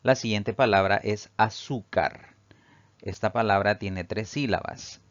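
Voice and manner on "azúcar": ZÚ of "azúcar" given extra weight; "azúcar" stressed on its second syllable; penultimate stress. Shown as a demonstration. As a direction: lean on the middle syllable of "azúcar". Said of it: "la mayor fuerza de voz recae".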